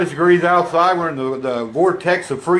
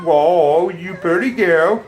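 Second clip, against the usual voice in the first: high, slow cooing praise; 4 semitones higher, much slower than usual. Real, not acted. Owner cooing praise at the dog, high baby talk. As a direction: coo at the pretty dog.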